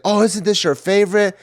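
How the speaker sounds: high mock mom voice; imitating mom. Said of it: "it's like".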